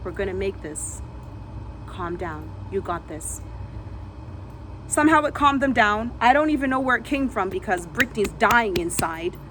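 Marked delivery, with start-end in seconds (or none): 0.0-3.4 softer, lower calming voice; soothing calm. Retold or imitated. She calms the terrified man beside her, soft and soothing. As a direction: breathe with him gently. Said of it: "I say".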